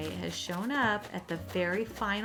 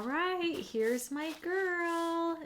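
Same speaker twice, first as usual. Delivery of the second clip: cooing; 9 semitones higher, much slower than usual. Real, not acted. Greeting new villager.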